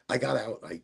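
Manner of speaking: normal talking voice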